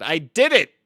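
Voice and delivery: hammed-up courtroom outburst; triumphant confession. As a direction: proclaim it to the judge. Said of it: "in the middle of a role play".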